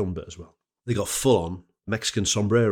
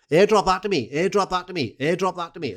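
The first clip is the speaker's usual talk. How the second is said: high, insistent put-on Welsh accent; Pontypridd-Merthyr accent; 9 semitones higher, 4 dB louder than usual. Fan wanting the drinking video sent, in an excitable Pontypridd-Merthyr accent. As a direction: chant the demand in high-pitched Pontypridd. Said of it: "I'll do Miss Pontypridd, uh, slash Merthyr".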